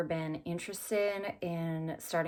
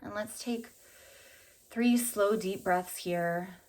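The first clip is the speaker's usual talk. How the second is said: unhurried meditation voice with long pauses; calm, spacious guided-visualization delivery. Acted. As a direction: guide them gently through an imagined walk, leaving space between phrases. Leads a walking meditation.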